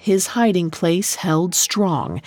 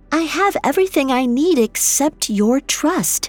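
higher, coaxing princess voice; Astrid's voice; 7 semitones higher than usual. Acted. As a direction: coax him sweetly, pitched up. Astrid coaxes the secret.

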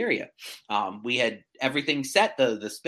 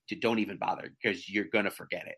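husband's deadpan warning; 7 semitones lower, 3 dB quieter, faster than usual. Retold or imitated. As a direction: lower and softer, a hint of a dry husband. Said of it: "my husband is like".

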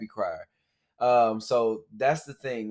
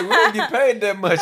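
higher, laughing through the line; laughing coworker; 11 semitones higher, 6 dB louder than usual. Retold or imitated. Podcaster laughs retelling a coworker's joke about pay, much higher. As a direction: crack up while saying it. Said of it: "He said".

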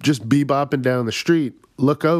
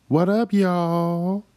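slow hype greeting; 7 semitones higher, much slower than usual. Pretend.

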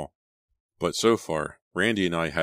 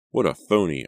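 louder, scornful inner jab; rising anger; 3 semitones higher, 7 dB louder than usual. A narrator's reading. Natalie thinks angrily about Todd's act, loud and scornful. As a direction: spit out the contempt. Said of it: "feeling my anger rise".

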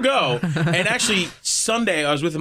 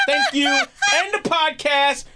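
triumphant sign-off; 11 semitones higher, slower than usual. Real, not acted. Mock triumphant exit.

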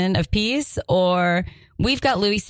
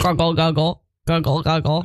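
low gargled alien talk; alien gurgling; 3 semitones lower than usual. Acted. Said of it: "[name] starts making gargling noises".

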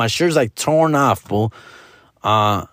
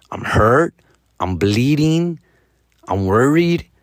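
normal talking voice

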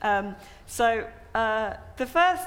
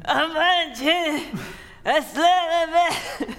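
villain's grand line; 7 semitones higher, 4 dB louder, slower than usual. Acted.

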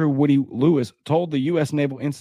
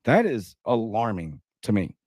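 ordinary talk

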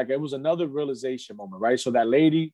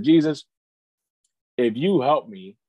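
earnest, slightly raised appeal, with pauses; retold plea; 3 semitones higher, 4 dB louder than usual. Retelling his prayer to Jesus, earnest plea. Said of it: "I cried out to Jesus".

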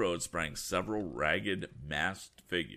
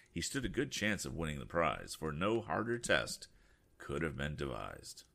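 ordinary delivery, nothing marked

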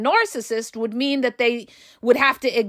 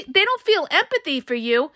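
usual speaking voice

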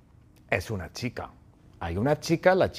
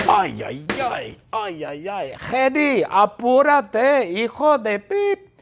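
high, drawn-out impatient yell; theatrical high shout of impatience; exasperated shouting; 8 semitones higher, 4 dB louder, slower than usual. Acted. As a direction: wail in exasperation, then yell to hurry. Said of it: "Y Bertita grita".

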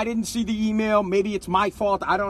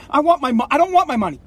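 raised, emphatic angry demand; real frustrated outburst; pissed off; 4 semitones higher than usual. Real, not acted. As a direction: cut yourself off and insist, higher. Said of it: "you see how pissed I am?".

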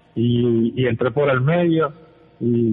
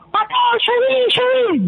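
imitated shout. Coach urging his defender forward from the touchline, imitated shout. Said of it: "me grita".

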